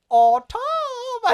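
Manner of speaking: hammed-up soaring call of a name; mock wailing call. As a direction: imitate the cry at full stretch. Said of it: "first heard him say".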